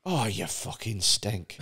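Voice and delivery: low, soft, slow sneer; contempt. Pretend. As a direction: murmur the insult with disdain. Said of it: "And they go".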